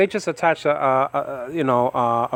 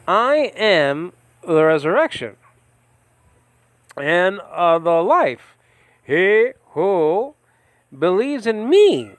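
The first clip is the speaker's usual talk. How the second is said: slow, higher and louder, reading the words of Jesus; preaching the verse, drawn out and raised; solemn scripture reading; 4 semitones higher, 3 dB louder, slower than usual. Acted. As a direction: proclaim each phrase with a pause after it.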